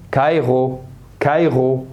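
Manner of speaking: كايغو with R as a throaty gh; teacher's model of كايغو, R made in the throat; guttural R. Shown as a demonstration. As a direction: say كايغو as "kaygho". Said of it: "هتنطقها إغ".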